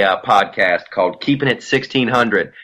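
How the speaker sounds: ordinary delivery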